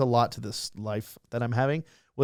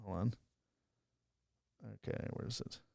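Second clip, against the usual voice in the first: very soft, unvoiced murmur; barely audible, under the breath; talking to himself; 3 semitones lower, 19 dB quieter than usual. Real, not acted. Guest hunts for the donation email, murmuring to himself.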